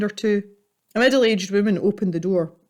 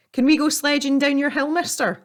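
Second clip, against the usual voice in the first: put-on boy's voice, higher and cheeky; a cheeky lad asking a favour; young boy; 8 semitones higher than usual. Boy asks the grumpy neighbour, a cheeky higher kid voice.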